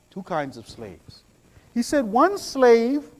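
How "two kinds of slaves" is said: softer, grave repetition; preacher repeating the point softly; quieter repeat. Shown as a demonstration. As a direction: let the repeat sink in.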